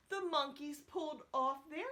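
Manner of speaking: ordinary delivery, nothing marked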